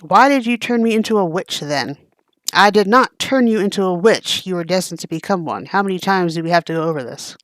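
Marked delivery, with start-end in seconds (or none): none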